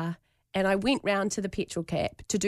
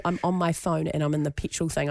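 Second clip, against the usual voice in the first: ordinary delivery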